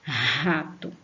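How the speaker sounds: "Rato" with a forceful, breathy h-like R at the start; "Rato" slow and deliberate, initial R strongly aspirated. Shown as a demonstration. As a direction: push air hard into the R of "Rato". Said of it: "a sílaba com força".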